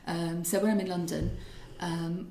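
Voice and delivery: ordinary delivery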